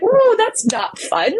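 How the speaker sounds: very high, slow, drawn-out wince; wry dismay. Retold or imitated. Walking away hurt, retold.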